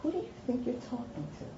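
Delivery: quick, hushed retort; negative comeback. Acted. Imagined reply to the nasty principal, quick muted retort. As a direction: fire back fast but keep it soft. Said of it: "If I responded back to him".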